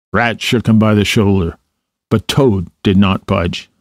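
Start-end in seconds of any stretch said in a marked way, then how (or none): none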